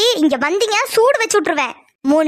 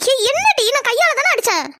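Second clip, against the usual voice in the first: high indignant protest; shrill retort; 6 semitones higher than usual. Acted. Sister shouts back at her angry sibling, high indignant protest. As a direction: snap back high and sharp.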